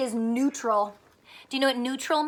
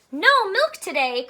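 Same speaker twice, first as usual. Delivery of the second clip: higher, louder cry; cried out; 6 semitones higher, 4 dB louder than usual. Acted. Farmer cries out to the striking cows. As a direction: cry it out in dismay. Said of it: "cried Farmer Brown".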